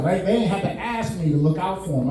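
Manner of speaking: normal talking voice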